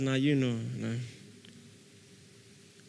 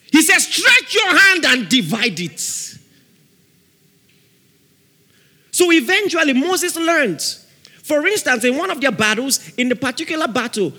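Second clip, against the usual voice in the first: loud, high fiery preaching; preaching at full force; 8 semitones higher, 7 dB louder than usual. Real, not acted. Preacher declares a shaking over the congregation, loud and fiery. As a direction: drive it home with rising fire.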